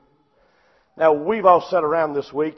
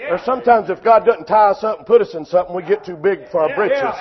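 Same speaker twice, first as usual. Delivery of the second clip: ordinary talk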